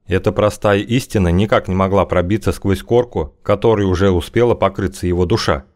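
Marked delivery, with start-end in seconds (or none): none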